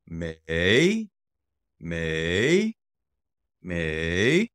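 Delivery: drawn-out MEI climbing from the bottom; third tone. Shown as a demonstration. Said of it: "Third tone, up and down".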